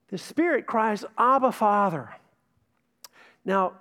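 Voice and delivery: usual speaking voice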